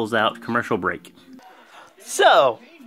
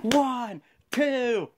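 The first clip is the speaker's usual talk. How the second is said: referee count; 10 semitones higher, 4 dB quieter than usual. Retold or imitated. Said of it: "he's like".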